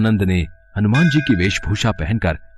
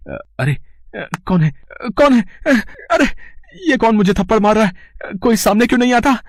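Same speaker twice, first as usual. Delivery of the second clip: much higher, frantic cries of alarm; panicked fright; 12 semitones higher than usual. Acted. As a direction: yelp in terror at an invisible slapper. Slapped by an unseen attacker.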